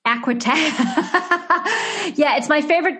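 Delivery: laughing through the answer, amused. Real, not acted. Actress laughing at the interviewer's aqua tank top question.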